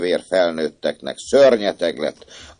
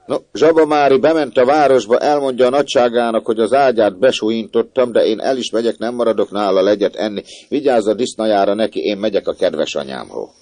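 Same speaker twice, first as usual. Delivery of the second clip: put-on defiant farm boy; 6 semitones higher, 7 dB louder than usual. A narrator's reading. Swineherd boy announces leaving.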